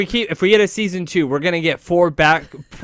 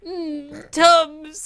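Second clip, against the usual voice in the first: mock whimper; 12 semitones higher, 3 dB quieter than usual. Mock whining over Tums.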